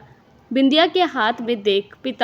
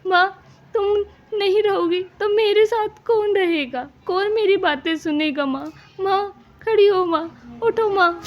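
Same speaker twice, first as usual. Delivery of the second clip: sobbing; 8 semitones higher than usual. Acted. Daughter begs her dead mother to wake, wailing. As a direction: cry out for her, voice breaking high. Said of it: "फूट फूट के रोने लगती है".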